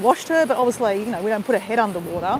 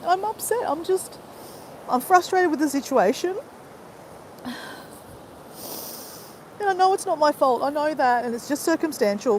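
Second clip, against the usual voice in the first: crying; 5 semitones higher, 4 dB quieter than usual. Real, not acted. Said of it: "Yeah, I am crying".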